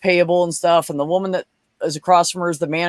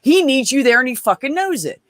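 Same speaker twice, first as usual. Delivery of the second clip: insistent retold advice; 7 semitones higher than usual. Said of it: "I said".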